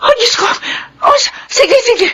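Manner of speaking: very high, breaking into tears; mother sobbing at the bad news; panicked crying. Mother panics hearing son's news. (umiiyak sa takot)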